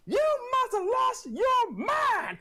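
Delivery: high shouted, slowed scold; scolding mom. Retold or imitated. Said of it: "something my mom would say".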